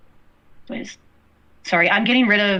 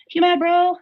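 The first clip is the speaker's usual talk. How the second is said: teasing taunt; 8 semitones higher, slower than usual. Real, not acted. Teasing the chat.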